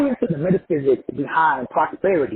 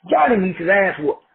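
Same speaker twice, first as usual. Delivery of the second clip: ordinary talk, nothing marked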